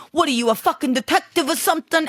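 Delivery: high, sneering Boston bad-girl voice; hammed-up Boston tough-girl accent; mock Boston accent. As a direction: imitate a tough Boston girl badly on purpose. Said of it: "she's trying to do this, like, Boston, bad bitch".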